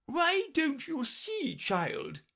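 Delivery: very high, imperious royal voice; Red Queen. A narrator's reading. Red Queen lecturing Alice, high and imperious. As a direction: talk down to the child.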